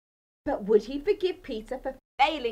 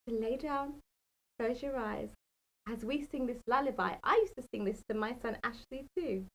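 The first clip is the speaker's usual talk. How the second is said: softer bedtime talk; sleepy bedtime voice; 3 semitones lower, 8 dB quieter, faster than usual. Real, not acted. Settling a child before a lullaby, soft bedtime voice.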